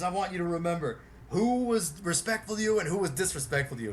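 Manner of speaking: herd the fans onto the bus, commanding; bossy Billy voice. Retold or imitated.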